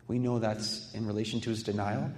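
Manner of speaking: usual speaking voice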